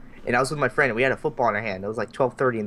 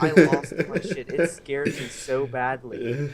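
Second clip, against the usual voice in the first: usual speaking voice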